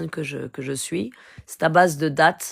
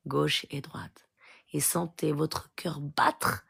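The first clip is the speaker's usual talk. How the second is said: soft, slowing meditation voice, drifting to a whisper; guided meditation; 6 dB quieter than usual. Acted. Preacher guiding the faithful into prayer, soft meditation voice. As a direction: talk them gently into feeling their heart.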